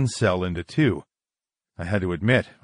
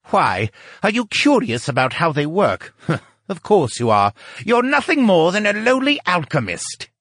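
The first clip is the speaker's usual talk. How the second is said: higher, derisive character voice with a chuckle; haughty sneer; 10 semitones higher, 3 dB louder than usual. A narrator's reading. Lucian belittling a lowly alchemist, haughty sneer. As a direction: look down on him, pitch climbing to the insult. Scientist sneers at his rival.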